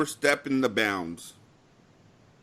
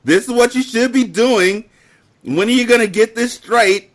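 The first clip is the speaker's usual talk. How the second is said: louder, higher scolding; scolding coworker; 7 semitones higher, 7 dB louder, slower than usual. Retold or imitated. Coworker scolding him by email, loud and higher. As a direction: talk down to the new hire.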